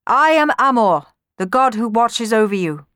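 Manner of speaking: loud, high, grand announcement; proclaiming god. A narrator's reading. Amor the god to Orpheus, grand proclamation. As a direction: proclaim it with authority.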